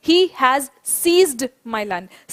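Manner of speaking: each word spaced out for the class to repeat; slow and deliberate, for the class to echo; teacher's slow dictation; slow model sentence. Shown as a demonstration. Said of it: "सारे बच्चों एक बार बोलेंगे".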